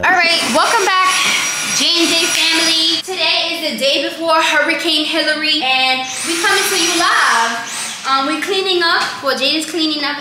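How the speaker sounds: loud, high vlog host intro; excited vlogger. Real, not acted. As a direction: pump up the viewers.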